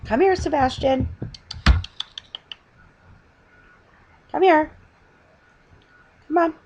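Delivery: high, sing-song coaxing call; coaxing a pet. Real, not acted. Grandmother coaxing Sebastian the cat to come say hi. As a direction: coax the cat over sweetly.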